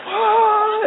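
short, high, loud mock scream; euphoric scream. Boys going nuts over Mickey, euphoric scream. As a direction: imitate kids going nuts. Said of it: "they're just going nuts".

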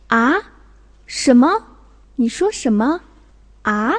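puzzled questions with rising endings; questioning. Shown as a demonstration. Teacher showing second-tone 啊, puzzled and questioning. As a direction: ask as if you didn't catch it. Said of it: "就表示疑问的意思".

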